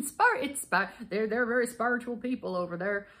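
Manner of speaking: put-on part Yorkshire, part Newfie, part Southern accent; badly faked Yorkshire-Southern mongrel accent; muddled accent. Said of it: "what even what that accent is".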